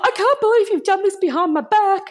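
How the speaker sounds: high, scandalised imitation of mum; mock reproach, pitched right up; dramatic mum. Betrayed mum scolds daughter on the phone, dramatic. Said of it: "my mum was very dramatic".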